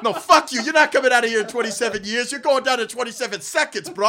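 high, loud mock gang taunt; tough street kid. Inner city kids taunting Pennywise, mock tough. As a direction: taunt the clown, laughing.

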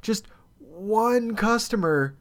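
"one" drawn out, high-pitched; retold rant. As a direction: lean long and high on "one". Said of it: "I go through this rant".

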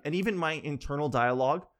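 ordinary delivery

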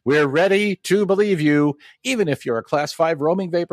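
high, energetic intro read; show intro announcer. Acted. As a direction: hype up the episode like a promo.

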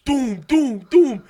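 loud, high 'doom' sounds; imitated thuds. Storyteller mimicking the carton being thrown, loud imitated thuds. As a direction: punch out each thud loudly.

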